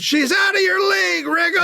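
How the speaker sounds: high, carrying heckler holler; imitated scream. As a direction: scream it from the back of a crowd. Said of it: "somebody from the back screams".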